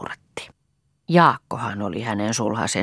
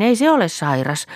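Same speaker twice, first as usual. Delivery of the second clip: put-on higher, louder little-girl voice; high child voice echoing a grown-up; child repeating an adult; 6 semitones higher, 5 dB louder than usual. A narrator's reading. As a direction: pass it on to mother as a small child. Child goes on reassuring mother.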